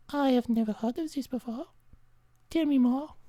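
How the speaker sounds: high, soft, quick asking; hammed-up eager fan; high eager stranger. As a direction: lean in curious.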